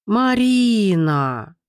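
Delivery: slow drawn-out howl of a name; протяжный вой. A narrator's reading. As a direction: wail it long and slow. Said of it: "провыла". (протяжно провыть)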